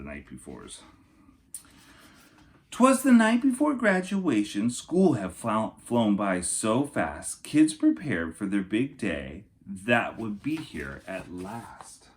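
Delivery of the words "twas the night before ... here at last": storybook narration for kindergartners; lilting rhyming picture-book voice; reading to children. Acted. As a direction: read it like story time for little kids. Reads Night Before book aloud.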